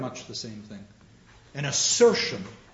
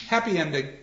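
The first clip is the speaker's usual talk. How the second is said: ordinary delivery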